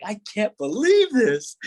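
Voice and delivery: high, slow, overwhelmed cry; retold bawling. At the sudden family reunion, overwhelmed. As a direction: stretch it out high in acted disbelief. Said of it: "I'm bawling and just like".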